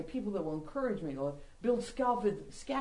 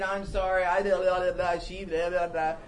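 mock loud apology; 5 dB louder than usual. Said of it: "I repented".

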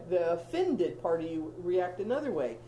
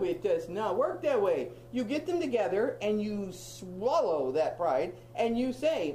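ordinary talk

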